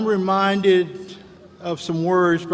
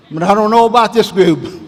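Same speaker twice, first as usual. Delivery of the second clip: louder, teasing jab at the crowd; mock suspicious call-out; 4 semitones higher, 10 dB louder than usual. Speaker to graduating class, louder playful teasing. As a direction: rib the raucous group with a loud playful dig.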